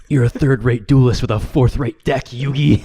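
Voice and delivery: sneering anime villain taunt. Acted. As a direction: gloat like a card-game rival.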